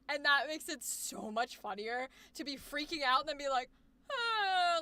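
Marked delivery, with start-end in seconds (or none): 4.1-4.8 long higher whine, falling away; whiny, pouty moan. Retold or imitated. Coach showing the funny turn after freaking out, whiny moan. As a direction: whimper out a pouty "ah". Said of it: "like, a little whiny".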